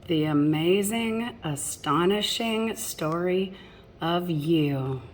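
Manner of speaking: lilting rhymed storybook read; reading to children. A narrator's reading. Parent reading a picture book to children, lilting rhyme. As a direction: read the rhymes to the little ones.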